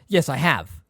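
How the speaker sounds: proud. A narrator's reading. Sirius agrees Harry resembles his father, proudly. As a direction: affirm it firmly as a point of pride. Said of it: "said Sirius proudly".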